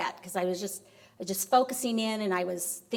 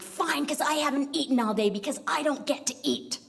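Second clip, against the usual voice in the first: higher, indignant acted complaint; huffy acceptance; 5 semitones higher than usual. Wife accepts dinner offer from husband, huffy. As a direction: give in crossly, as a retold sulk.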